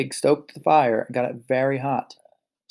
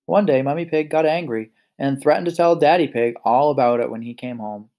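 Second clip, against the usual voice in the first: ordinary delivery, nothing marked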